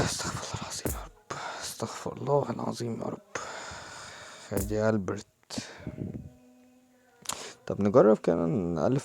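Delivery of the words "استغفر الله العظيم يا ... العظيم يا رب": sighing, whispered plea for patience; whispered prayer. Real, not acted. Gamer whispers a prayer for patience after a bad pack. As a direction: breathe it out under your breath.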